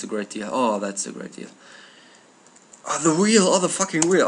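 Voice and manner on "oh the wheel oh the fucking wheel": excited. Real, not acted. Delighted at a game discovery.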